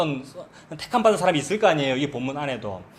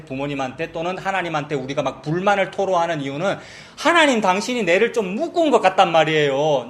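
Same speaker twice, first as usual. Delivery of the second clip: ordinary delivery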